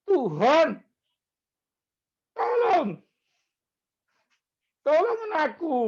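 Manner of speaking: high, pleading cry for help, retold; desperate plea. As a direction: cry out to God like someone drowning. Retelling his own desperate prayer. (memohon putus asa dengan suara tinggi)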